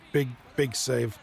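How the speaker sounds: normal talking voice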